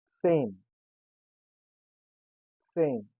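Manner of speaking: same said as German zehn, "tsayn"; German pronunciation. Shown as a demonstration. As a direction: hiss the first sound of same. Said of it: "sounds as S".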